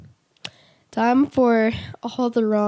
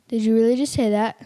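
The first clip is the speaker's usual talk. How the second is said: ordinary talk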